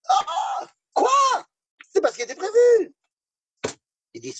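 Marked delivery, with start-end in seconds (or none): 0.1-2.9 high, startled outrage; shocked elders. Acted. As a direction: gasp in disbelief.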